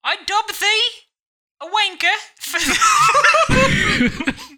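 very high, loud mock-regal voice; hammed-up royal falsetto, breaking into laughter; the Queen. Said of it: "I remember watching the Queen knight someone".

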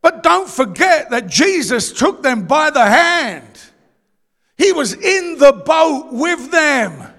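slow, loud, weighty delivery; stretched-out preacher's proclamation; emphatic preaching. Real, not acted. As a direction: drive each word home to the congregation.

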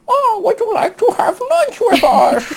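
put-on very high, silly English accent; hammed-up English accent. Imitating English friend's lunch invitation, absurdly high English accent. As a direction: squeal the invitation in a badly faked English accent. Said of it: "let me do the accent".

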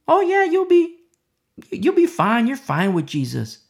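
high, breezy mocking voice of a false Jesus; mock a smooth false savior, airy and permissive; fake Jesus. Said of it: "a fake Jesus will say".

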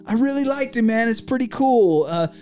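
eager cop fan. Retold or imitated. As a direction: gush like a starstruck cop. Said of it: "He goes".

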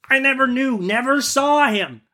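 high-pitched, alarmed merchant; exclaiming merchant. Acted. Merchant denies killing the genie's son, high exclamation. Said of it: "exclaimed the merchant".

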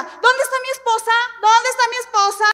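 loud, high mock yell; hammed-up urgent calling out; imitated shouting husband. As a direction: bellow for your wife across a crowded church. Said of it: "Y gritaba".

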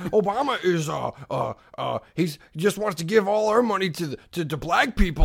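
lightly voiced blustering pundit; mock political rant, stumbling over the start; Rush Limbaugh. Cheetos eater ranting about Obama, blustering impression. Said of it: "go into your Rush Limbaugh".

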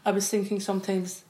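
normal talking voice

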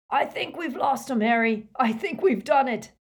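high, loud relieved call; yelled. Acted. Hagrid telling Harry they escaped, loud relieved yell. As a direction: shout it out with relief. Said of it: "yelled Hagrid".

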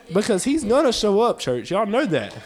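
loud, driving preacher's cadence; preaching. Acted. Preacher urging the church with a loud driving voice. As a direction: build the sermon hard and loud.

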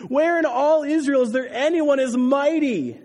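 high, loud mocking call; taunting. A narrator's reading. David mocks Abner from the hilltop, taunting loudly. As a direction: keep goading him across the distance. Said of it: "David taunted".

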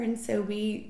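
usual speaking voice

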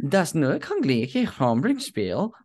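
slow mock German accent on Das Nu Konglige Hombre Spiel; playful German accent on request. Shown as a demonstration. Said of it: "do it in a German accent".

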